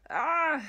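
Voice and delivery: sudden loud high cry of frustration; frustrated yell. Real, not acted. Vlogger venting about a late upload, a loud frustrated cry. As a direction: let out a burst of exasperation.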